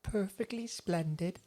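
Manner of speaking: put-on high, slow prim little girl. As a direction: say it like a prim ghost child. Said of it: "she's always just like".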